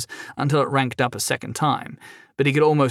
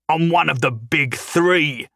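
half-hearted angry shout, drawn out; mock yell; 4 semitones higher, slower than usual. Angry crime lord boasts to his prisoner, mild slow yell. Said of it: "Silver yelled".

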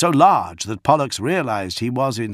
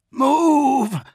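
high, loud shouted command; one long strained yell; urgent cry; 5 dB louder than usual. A narrator's reading. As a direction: cry out to get him moving. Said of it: "Dantiok cried".

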